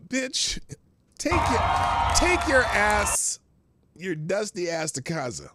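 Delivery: high, loud yell at the protester; angry shout. Real, not acted. Streamer yelling at a protester on screen, loud angry shout.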